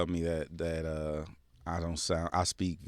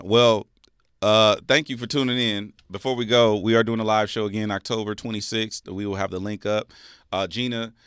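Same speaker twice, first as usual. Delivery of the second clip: louder host sign-off voice; podcast outro; 10 dB louder than usual. Acted. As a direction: wrap up the show like an announcer.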